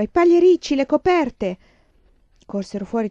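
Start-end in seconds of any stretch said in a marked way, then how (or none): 0.0-1.6 loud, high hurried cry; urgent shout. A narrator's reading. Laura shouts to save the bedding from rain, loud urgent cry. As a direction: yell it, racing the rain. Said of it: "gridò Laura".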